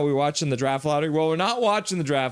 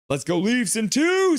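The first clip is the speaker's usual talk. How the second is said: high-pitched rallying cry; acted fan cheer; 10 semitones higher, 3 dB louder than usual. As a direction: read it out as an excited fan. Reads out a fan's cheer.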